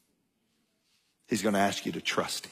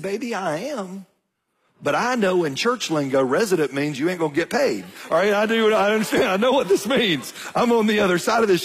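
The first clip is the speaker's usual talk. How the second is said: higher, louder worried protest; exasperated dad; 8 semitones higher, 4 dB louder than usual. Retold or imitated. Dad pleading with daughter about unpaid job, exasperated. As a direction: push back, half laughing at the news. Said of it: "I said".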